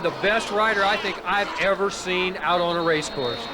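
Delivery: usual speaking voice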